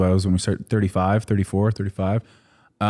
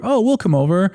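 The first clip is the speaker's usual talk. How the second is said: high, breezy impression of parents; lightly mock his parents' easygoing eagerness; eager parents; 10 semitones higher than usual. Parents offering to visit Australia, imitated eager high voice.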